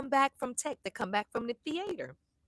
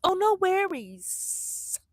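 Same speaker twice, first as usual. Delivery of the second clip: breezy son; 9 semitones higher, much slower than usual. Retold or imitated. Son brushing off mom.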